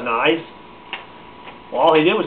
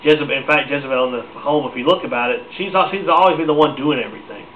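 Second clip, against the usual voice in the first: ordinary delivery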